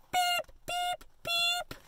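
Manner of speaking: tiny, high-pitched beeps; little truck horn. A narrator's reading. Little truck ends the story, high squeaky beeps. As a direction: squeak three separate soft beeps.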